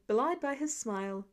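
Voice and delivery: usual speaking voice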